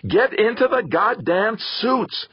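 exasperated roared command; roaring. A narrator's reading. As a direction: roar it at her, fed up. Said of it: "Theo roared".

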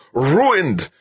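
raging final outburst; furious roar. A narrator's reading. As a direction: roar the loss at full force. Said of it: "Zimmerman roared".

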